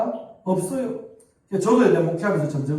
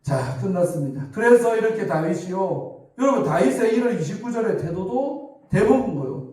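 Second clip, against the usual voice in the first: ordinary talk, nothing marked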